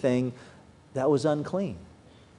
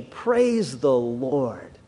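slow praise; 6 semitones lower, much slower than usual. Retold or imitated. Quoting what believers say.

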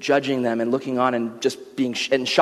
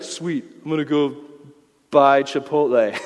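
clueless shrug. Pretend. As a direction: play the oblivious guy who doesn't get it. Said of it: "You wouldn't be like".

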